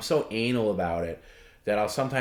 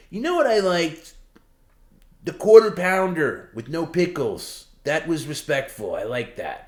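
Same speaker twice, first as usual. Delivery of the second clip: high, loud Perry impression; mocking Perry; 8 semitones higher, 6 dB louder than usual. Helper mimicking Perry praising a fan's gift. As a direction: ham up his praise. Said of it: "he will bring up stuff like that".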